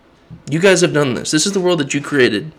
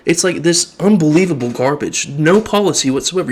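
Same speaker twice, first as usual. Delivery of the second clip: ordinary delivery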